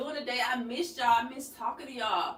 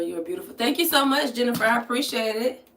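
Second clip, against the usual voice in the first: ordinary talk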